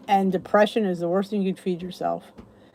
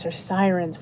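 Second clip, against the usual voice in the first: ordinary talk, nothing marked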